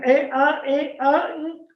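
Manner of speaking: high, hesitant stammer; stammering pupil. Retold or imitated. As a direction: stumble over nothing like a daydreaming kid. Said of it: "el papamoscas decía". (niño distraído tartamudeando agudo)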